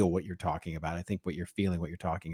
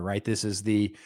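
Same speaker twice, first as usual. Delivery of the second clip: ordinary talk, nothing marked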